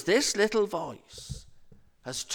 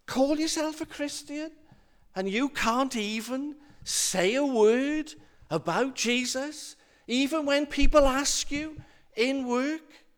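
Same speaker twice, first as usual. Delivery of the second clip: higher-pitched, lightly acted reproach; accusing inner voice; 7 semitones higher than usual. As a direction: challenge them like a nagging conscience, lightly voiced. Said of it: "The little voice sits on your shoulder".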